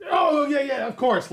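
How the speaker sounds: high, loud eager yes; nervous eager agreement. Acted. New manager eagerly agreeing with his superior, high and loud. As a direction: rush to agree with the boss.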